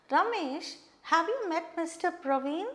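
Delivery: "Praveen" rising at the end, name "Ramesh" pitched high; questioning rise. Shown as a demonstration. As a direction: ask it with the voice going up on "Praveen". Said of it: "look at my change of tone".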